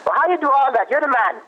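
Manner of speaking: high, mock West Indian mother, disbelieving; playing his West Indian mom grilling him; imitating mom's accent. Son imitates his mother's accent asking about chores. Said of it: "invoke her with the accent".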